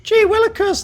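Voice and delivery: high, silly sixties-style gee whiz voice; ridiculous mocking voice. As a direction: squeak it out like a corny old-timey kid. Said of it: "that ridiculous voice as though someone's talking and you're obviously mocking them".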